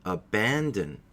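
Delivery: abandon again with stress on ban; abandon, middle syllable stressed; repeat model word. Shown as a demonstration. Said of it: "Please listen and repeat".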